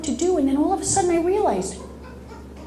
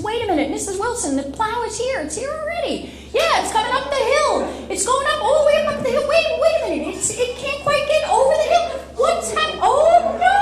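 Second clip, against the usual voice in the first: higher, laughing running commentary on the phone; excited plow driver; 11 semitones higher, faster than usual. Retold or imitated. Plow driver on the phone to Mrs Wilson, higher and laughing. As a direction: narrate the plow's climb with rising alarm. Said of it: "He says".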